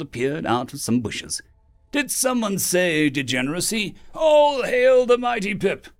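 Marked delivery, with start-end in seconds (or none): 1.9-5.9 earnest kobold proclamation; seriously. A narrator's reading. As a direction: hail Pip with mock gravity. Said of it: "he said seriously".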